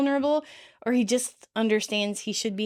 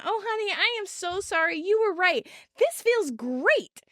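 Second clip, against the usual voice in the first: higher-pitched gushing apology; excited apology; 8 semitones higher than usual. A narrator's reading. Rachel apologizes while jumping around, excited. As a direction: gush it, stretching the last words. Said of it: "apologizes as she continues to jump around".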